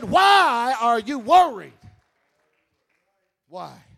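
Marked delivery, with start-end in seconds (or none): 0.0-1.8 loud, slow preacher's cry; shouted question. Shown as a demonstration. Preacher crying out Jesus' question to the congregation, loud and slow. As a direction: hammer the question home to the crowd. Quote shouted back at climax.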